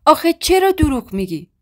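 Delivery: angry pleading. A narrator's reading. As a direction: break out in outraged disbelief. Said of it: "با تعجب و خشم تو چشام نگاه کرد". (با خشم و ناباوری، بلندتر)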